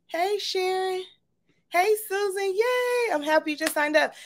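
high, bubbly welcome with a squealed yay; excited greeting. Real, not acted. Host squealing hello to viewers who just signed up, high and excited. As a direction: gush over each new name popping in. Greeting new sign-ups on stream.

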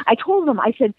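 ordinary delivery

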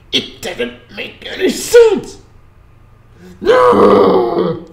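slow, high, drawn-out wail of protest; exasperated outburst. Real, not acted. Reviewer protesting a plot that makes no sense, exasperated. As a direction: stretch each word, then cry out high and loud.

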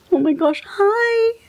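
very high-pitched coo to a pet; cooing pet greeting. Real, not acted. Owner finding Buttercup in the closet, high cooing greeting.

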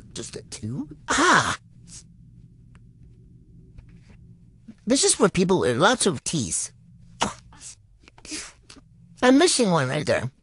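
chewing while talking; biting into candy stick; mouth full of candy. Real, not acted. Seen 1: Bites licorice stick at mouth, then talks while chewing; later just holds it.

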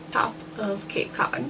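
slow whisper; slow whispered aside. Real, not acted. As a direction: breathe it out in a whisper. Outtake whispered line.

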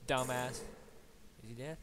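normal talking voice

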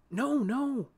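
high, urgent little warning; gentle hurried warning; quick soft caution. A narrator's reading. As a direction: stop her gently but urgently. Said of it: "Sol said quickly but softly".

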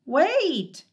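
high loud call; shouted. A narrator's reading. Moomin Troll calling after the Hemulan, shouting. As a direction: shout after him to stop. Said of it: "Moomin Troll shouted".

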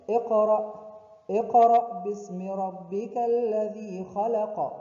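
tajweed recitation; Quran recitation. Acted. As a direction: chant the opening verse. Recites the verse.

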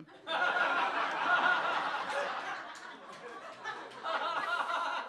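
long, real burst of laughter; real, drawn-out laughing fit. Real, not acted. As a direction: laugh it out helplessly. Laughs at own retort.

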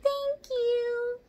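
high, slow tiny mouse squeak; squeaky mouse. Acted. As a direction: squeak like a scared baby mouse. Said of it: "squeak all the mice".

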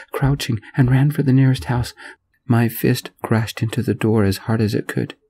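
plain reading voice

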